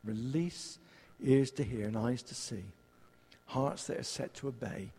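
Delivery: fervent then hushed prayer. Real, not acted. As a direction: pray over the room, easing down. Leads prayer invoking the Spirit.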